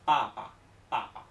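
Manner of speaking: PAPA loud, with unaspirated p; Chinese pronunciation. Shown as a demonstration. Teacher repeats the correct Chinese word, voiceless PAPA. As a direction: hit the first PA firmly, let the second fall. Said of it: "en chino se dice".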